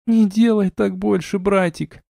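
high-pitched, lightly tearful plea; tearful little sister. A narrator's reading. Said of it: "всхлипнула сестрёнка".

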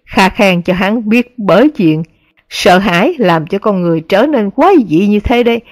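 ordinary delivery

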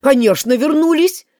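loud, triumphant crowing; triumphant shout. Acted. King gloats to the court, loudly triumphant. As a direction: crow in triumph, pointing at the dish. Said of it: "закричал король с торжеством".

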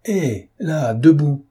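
high, loud, outraged command; indignant shout. Acted. The count, outraged, orders the whistling Lupin up. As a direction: snap him to his feet with outrage. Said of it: "d'un air indigné".

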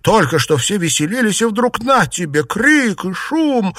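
high-pitched, alarmed exclaiming; astonished, pitch soaring at the end; startled exclamation. A narrator's reading. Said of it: "воскликнула она".

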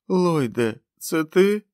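high, strained, hopeful call; a ruined man's thin question; dying man. A narrator's reading. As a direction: reach out blindly with your voice. Dying man searching for Lloyd.

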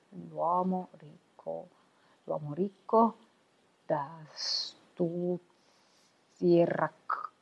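slow, stumbling, word by word; halting reading aloud. Acted. Odino reading the Odyssey aloud, unpractised, slow and stumbling. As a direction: sound out each word, stopping and restarting. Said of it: "ma non ti sei esercitato per niente".

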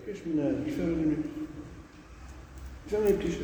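hushed, puzzled question to oneself; puzzled aside. Real, not acted. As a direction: drop the volume and wonder about it. Muttered puzzled question, soft aside.

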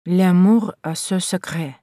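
slow, careful reading in French. A narrator's reading. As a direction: read out the French inscription slowly. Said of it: "Это по-французски". (медленно, по-французски)

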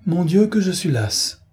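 weary exclamation. A narrator's reading. Clotilde exclaims to her mother, exhausted and dramatic. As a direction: sigh it out as a pampered lady. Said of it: "s'écria madame d'Eckmore".

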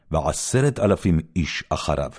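ordinary delivery, nothing marked

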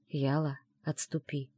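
barely voiced, very soft; mouthed words. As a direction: breathe it almost silently. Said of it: "одними губами произнёс Айдер".

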